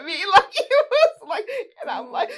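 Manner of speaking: real laughter; laughing hard. Real, not acted. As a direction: laugh through the words. Laughing recalling rollback.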